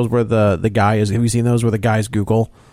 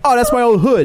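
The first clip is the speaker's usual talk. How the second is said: high, excited forum guy; 10 semitones higher, 3 dB louder than usual. Retold or imitated. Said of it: "one guy's like".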